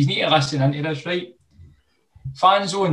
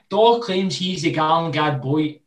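normal talking voice

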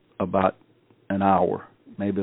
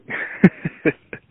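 high breathless laugh; 8 semitones higher, 4 dB louder than usual. Real, not acted. Laughing at his own fear.

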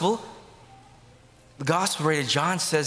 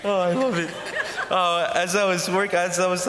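laughing speech; 4 semitones higher than usual. Real, not acted. Laughing after his impression.

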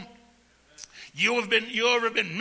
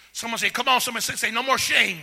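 loud call and response, rising; rally the congregation with every repeat; full-force preaching; 4 semitones higher, 6 dB louder than usual. Acted. Preacher leading the church in a loud chant.

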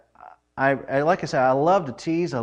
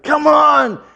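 impatient shout; 12 semitones higher, 7 dB louder than usual. Retold or imitated. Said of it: "I'm like".

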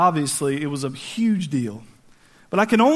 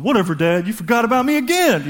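aggrieved whine rising at the end; hammed-up sulky protest; stomping teenage huff; 4 semitones higher than usual. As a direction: complain like a slighted son storming in. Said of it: "imagine David walking in, stomping".

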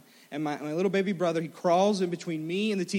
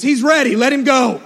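loud, high-pitched shouted order; calling out; 7 semitones higher, 11 dB louder than usual. Retold or imitated. Grandpa holds boy down and yells to gate, shouting. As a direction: holler it across the arena. Grandpa calls out to release calf.

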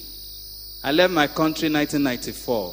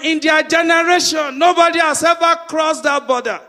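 higher, loud preaching cadence; 12 semitones higher, 7 dB louder than usual. Acted. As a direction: preach it out to the congregation. Preacher at full force.

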